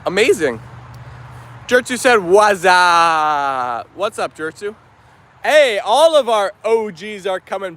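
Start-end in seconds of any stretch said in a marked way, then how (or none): 2.2-3.9 long, drawn-out comic "wazzup" holler; mock hollered greeting. Streamer acts out a viewer's chat greeting, goofy holler. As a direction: stretch the last vowel out into a goofy holler.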